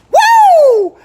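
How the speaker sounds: loud, high celebratory whoop; big happy woo; excited whoop. Real, not acted. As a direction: let out a joyful yell. Hyped start of live reveal.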